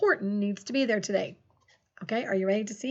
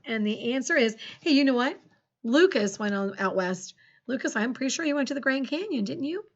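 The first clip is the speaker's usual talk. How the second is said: normal talking voice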